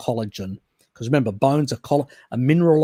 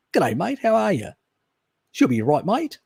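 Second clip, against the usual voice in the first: hammed-up Aussie greeting, high and swooping; broad Australian accent; 8 semitones higher than usual. Answering a viewer who says he lacks an accent, hammed-up Australian. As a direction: lay on thick Aussie mateyness, pitch leaping up. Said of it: "such a funny accent like a, a, Australians normally do".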